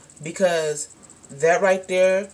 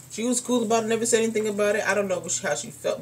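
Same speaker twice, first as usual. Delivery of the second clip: ordinary talk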